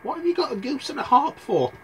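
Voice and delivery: high-pitched mother and son; high panicked voice. Acted. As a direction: hurry mum along in a high voice. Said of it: "Jack went".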